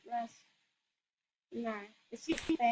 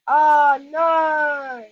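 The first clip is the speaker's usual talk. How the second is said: startled cry; 9 dB louder than usual. Real, not acted. Character suddenly gets attacked.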